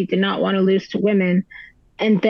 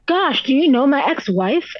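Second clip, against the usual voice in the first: imitating his exasperated scoff, pitched up; mock huffy retort, higher voice; retold scoff; 5 semitones higher than usual. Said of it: "he scoffs, and then he says".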